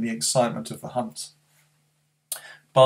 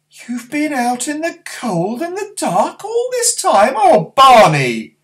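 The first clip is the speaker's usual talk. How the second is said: put-on high, slow fretting old woman; granny anxious about her late grandson; worried granny; 14 semitones higher, 11 dB louder, slower than usual. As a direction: fuss over him like an anxious granny.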